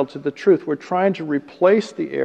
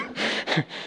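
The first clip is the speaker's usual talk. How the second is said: soft laugh; 10 dB quieter than usual. Real, not acted. Laughs at own story.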